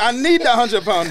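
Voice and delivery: slowed, pressing insistence on need; emphatic demand. Retold or imitated. Repeats the demand.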